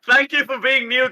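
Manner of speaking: teasing echo. Retold or imitated. Co-player echoes host, teasing.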